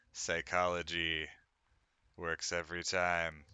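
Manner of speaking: usual speaking voice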